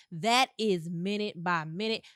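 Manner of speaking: usual speaking voice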